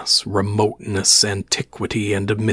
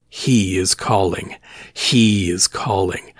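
"He" drawn out and leaned on; slow phrase with weight on "He"; stress on He; slower than usual. A narrator's reading. Repeating what the crazed crewman said, stress on "He". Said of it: "underlining the words he emphasized".

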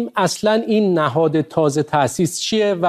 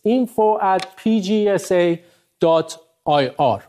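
slow, letter by letter; careful dictation pace; spelled out slowly; much slower than usual. Real, not acted. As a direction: dictate each letter clearly so they can write it down. Spelling out an email address.

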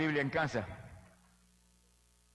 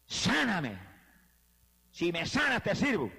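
higher, pleading cry from the floor; desperate plea; 4 semitones higher, slower than usual. Retold or imitated. Man on the floor begging God to heal him, pleading. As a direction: beg God for healing, voice lifted. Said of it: "y dije".

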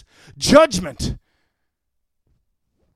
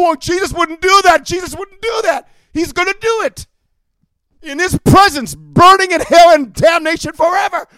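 high, loud preaching shout; high, loud fire-and-brimstone shout; fiery preaching; 9 semitones higher, 9 dB louder than usual. Acted. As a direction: thunder it at the congregation. Preaching at full force.

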